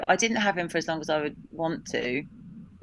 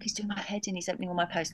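hushed complaint; 8 dB quieter, faster than usual. Retold or imitated. Sister complaining about dad opening post.

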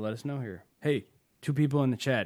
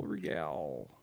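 deep low; 10 semitones lower, 7 dB quieter than usual. Pretend.

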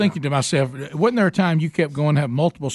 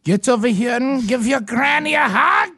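high, slow mock German accent; German granny; 5 semitones higher, slower than usual. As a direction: play a commanding granny with a hammed-up German accent. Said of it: "If your grandmother speaks like a German".

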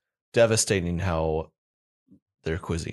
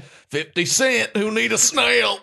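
high, slow rapper impression; hammed-up rapper voice, drawn out; 13 semitones higher, 6 dB louder, slower than usual. Mock rapper voice about cheap food.

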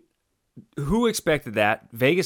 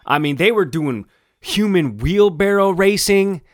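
much higher and louder disbelief; incredulous rant; 7 semitones higher, 9 dB louder than usual. Real, not acted. Co-host venting about the team's celebration, loud and high. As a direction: push it up in outraged disbelief.

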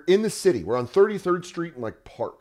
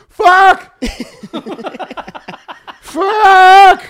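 a much higher, louder squeal of panic; panicked dog voice; 13 dB louder than usual. Retold or imitated. Scared dog in the car yelping at its owners, high panicked squeal.

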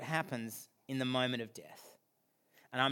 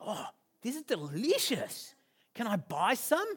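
put-on delighted kid; 5 semitones higher than usual. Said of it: "He's like".

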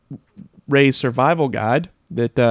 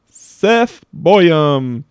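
louder winner reveal; hyped giveaway announcement; 3 semitones higher, 7 dB louder than usual. Acted. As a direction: call out the name like a prize host.

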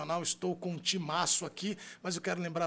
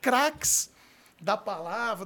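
hype announcer; 5 semitones higher, 6 dB louder than usual. Acted. Hyping the guests.

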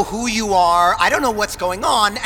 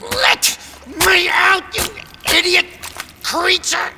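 angry, pitched-up yell; furious shout; 11 semitones higher than usual. Acted. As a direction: scream at the beast to let you out. Yelling at the whale.

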